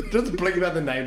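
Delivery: laughing. Real, not acted. Friend doxed himself.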